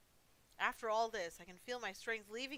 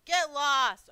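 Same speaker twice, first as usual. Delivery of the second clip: loud, higher sudden yell; louder, higher outburst; shout; 6 semitones higher, 16 dB louder than usual. Real, not acted. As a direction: snap it out at the screen. Streamer shouts at jumpscare.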